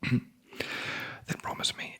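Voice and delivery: ordinary delivery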